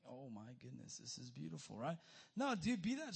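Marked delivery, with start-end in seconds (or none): 0.0-1.8 soft, low-key musing of a contented fish; idle fish. Acted. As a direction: play a fish idling in its tank, quietly. Voicing an idle tank fish.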